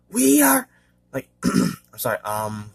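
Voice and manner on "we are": much higher, louder creature voice; high, forceful monster impression; high-pitched monster voice. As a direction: imitate one head of a hydra, pitched way up. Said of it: "high pitch or a slitherous voice".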